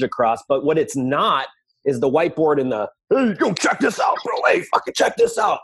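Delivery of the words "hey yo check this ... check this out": much higher, hammed-up hype-bro voice; mock bro. Mocking a bro filming a market update, hammed up and high. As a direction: parody a try-hard video host.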